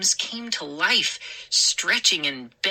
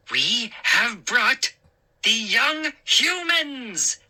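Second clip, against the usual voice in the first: slow, high, gleeful gnome proclamation; gnome announcing; 6 semitones higher, slower than usual. A narrator's reading. Hap presents the captured kids to the gnome crowd, slow and proud. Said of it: "Hap announced".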